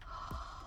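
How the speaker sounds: sharp breathy gasp of delight; awed gasp. Real, not acted. Unboxing new polish, gasping in delight. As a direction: gasp at the pretty colors.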